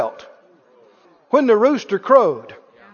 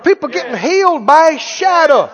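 loud, raised preacher's proclamation; full-force preaching; 4 semitones higher, 5 dB louder than usual. Real, not acted. Preacher telling of healings in Acts, preaching at full force. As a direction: proclaim the miracles with a shout.

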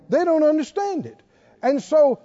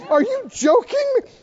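much higher, incredulous mock voice; mocking doubter; 11 semitones higher than usual. People who don't understand honor, in a high mocking voice. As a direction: squeak it like a scoffer who can't believe it. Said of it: "they go".

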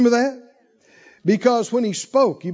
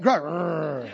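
low growl on the word; 4 semitones lower than usual. Shown as a demonstration. Preacher shows congregation a lion's fight, growling. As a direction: let the word turn into a drawn growl. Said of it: "You need to growl a little bit".

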